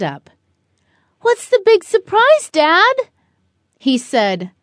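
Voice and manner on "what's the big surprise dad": much higher, louder, eager question; can't wait for the surprise; excited little girl. Acted. Excited girl asks dad.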